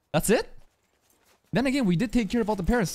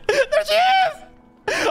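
very high, drawn-out squeal of excitement; shrieking fan welcome; hyped-up scream; 4 dB louder, much slower than usual. Real, not acted. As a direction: scream it slowly, totally hyped. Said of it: "I'm getting fucking hyped".

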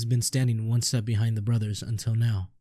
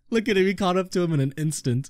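laughing; 8 semitones higher than usual. Real, not acted. Laughing at the chase.

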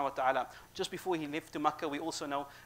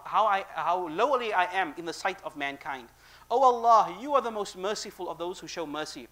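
louder pleading prayer, building up; impassioned prayer; 3 semitones higher, 5 dB louder than usual. Acted. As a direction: cry out to God in distress. Dua rising in intensity.

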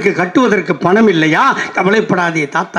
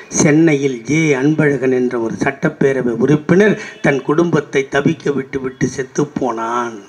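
solemn mourning; 6 semitones lower, 3 dB quieter than usual. Real, not acted. Speaker honouring party workers who died, lower and softer in mourning. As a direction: lower and softer, grave tribute.

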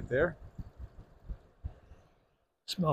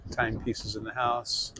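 normal talking voice

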